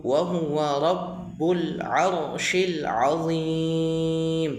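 measured chant with long-held vowels; slow Qur'anic recitation. Shown as a demonstration. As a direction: recite slowly for learners, stretching the final word.

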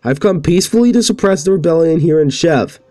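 put-on a young royal begging her brother to back down; princess pleading.

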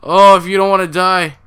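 frantic shouting. Real, not acted. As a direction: yell directions while fleeing in fear. Fleeing monster with friends.

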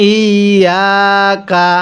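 very slow, drawn-out ইয়াকা; model recitation. Shown as a demonstration. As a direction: hold the doubled ya in ইয়াকা on a level tone. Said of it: "আরেকবার পড়বেন".

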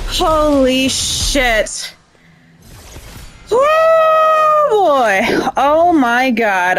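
stunned, then a high yelp; shaky disbelief after winning; adrenaline rush. Real, not acted. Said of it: "My heart is pounding".

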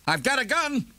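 high, startled shout of a gun threat. A narrator's reading. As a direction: yell it through the door at a looter. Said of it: "stepped toward the glass door and shouted".

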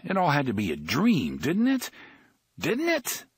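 anxious, high rising query; pleading disbelief. A narrator's reading. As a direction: voice climbing high on the questions. Eddie's panicked inner plea.

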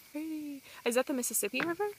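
usual speaking voice

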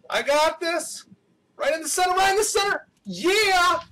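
high-pitched, rising excitement; excited self-cheering. Real, not acted. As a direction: urge yourself on, climbing to a high "yeah". Tense race, cheering self on.

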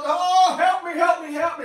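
hammed-up loud high wail for help; mock desperate cry. Pastor mocking a Survivor contestant, loud mock wail. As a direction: mimic the contestant begging God. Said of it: "But he's all".

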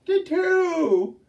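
very high, loud shriek. Acted. Younger sister quarreling with the older, shrieking. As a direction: shriek it back at her sister. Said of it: "shrieked the younger".

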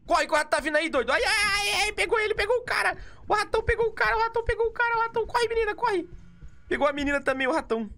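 being chased. Real, not acted. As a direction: yell in terror while fleeing. Rat chasing, catches others. (gritaria apavorada)

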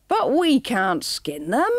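mild slow whine; a touch of a whimper; understated wail. Acted. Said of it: "wailed Jasper".

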